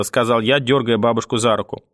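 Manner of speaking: plain reading voice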